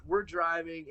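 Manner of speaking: ordinary talk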